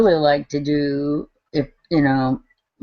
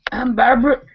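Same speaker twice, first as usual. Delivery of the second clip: choking, clearing the throat; 6 semitones higher, 3 dB louder than usual. Real, not acted. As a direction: talk through a choking cough. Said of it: "I'm choking to death".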